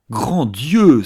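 sudden loud outburst of shock; shocked exclamation. A narrator's reading. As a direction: cry out, startled out of your seat. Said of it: "s'écria Archer en se levant d'un bond".

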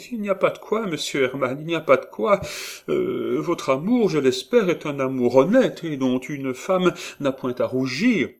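high, simpering prim woman; coy old housekeeper; voix de gouvernante minaudière. A narrator's reading. Perrine answers coyly. (voix aiguë et minaudière de Perrine)

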